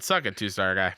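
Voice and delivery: low, taunting jab; mock taunt. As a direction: say it like a playful taunt. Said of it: "I'll also say it".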